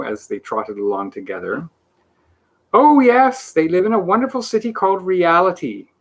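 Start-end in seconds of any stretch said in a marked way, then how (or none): 2.7-5.9 high, loud proclamation; announcing. A narrator's reading. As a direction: proclaim it grandly. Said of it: "he announced".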